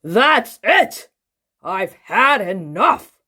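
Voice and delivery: loud, fed-up outburst; Mister Uppity bursts out, fed up with the party; cried out. A narrator's reading. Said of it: "cried Mister Uppity".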